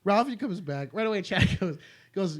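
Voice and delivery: ordinary talk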